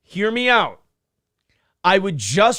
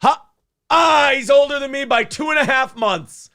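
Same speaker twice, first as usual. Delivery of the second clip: gloating laugh; 7 semitones higher than usual. Real, not acted. Gloating over being younger.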